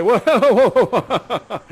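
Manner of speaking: loud, high string of whoas; protesting interruption. Acted. Father halting the young lovers, loud high theatrical protest. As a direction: cut in loudly to halt the lovers. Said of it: "And I say".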